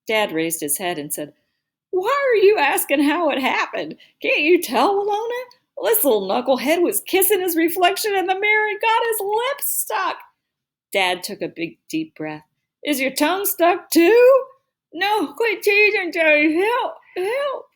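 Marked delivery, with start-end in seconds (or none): 1.9-10.2 high, loud exasperated dad; loud incredulity. A narrator's reading. Dad incredulous, trying not to laugh. 12.9-14.5 high, slow, loud mock-concerned question. A narrator's reading. Dad loudly questions stuck son. 14.9-17.6 high, slow garbled cry; mouth stuck. A narrator's reading. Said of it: "like he had something in his mouth".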